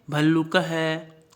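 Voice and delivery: वृषभ है drawn out slowly; slow, clear reading; slow recitation. Shown as a demonstration. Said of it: "साथ में उच्चारण".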